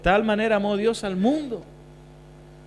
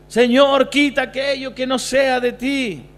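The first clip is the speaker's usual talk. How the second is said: praying youth; 6 semitones higher, 5 dB louder, slower than usual. Retold or imitated. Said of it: "cuántos han orado así".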